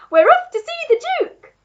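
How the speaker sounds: high-pitched, loud, gleeful call; monkey crying out. A narrator's reading. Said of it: "cried the monkey".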